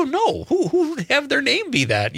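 high, incredulous "No"; retold friend in mock disbelief. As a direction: scoff in disbelief. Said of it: "I go".

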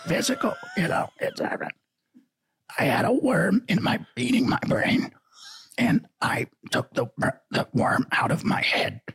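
strained, raspy politician with strangled breaks. Acted. Comic explaining his brain worm to listeners, strained raspy R F K voice. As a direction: force the words through a tight, croaking throat. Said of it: "your R F K impression".

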